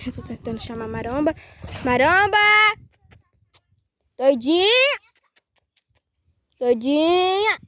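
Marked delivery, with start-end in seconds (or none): 1.8-7.6 chamado alto e agudo para os animais; chamando as vacas. Real, not acted. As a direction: grite o nome para a vaca vir. Chamando a vaca no pasto. (chamando a vaca alto e agudo)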